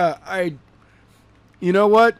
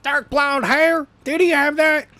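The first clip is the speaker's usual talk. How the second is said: mocking high voice; 11 semitones higher than usual. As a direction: pitch it way up and drag it out. Mocking the Jackal's question.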